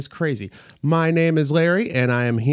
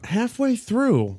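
much higher, slow and drawn out; high slow echo; 9 semitones higher, slower than usual. Acted. Echoed with a high slow drawl.

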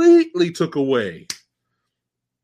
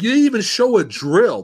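ordinary talk

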